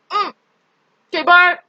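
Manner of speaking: talking with a sore tongue; speaking after biting tongue; just bit tongue. Real, not acted. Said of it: "I bit my tongue".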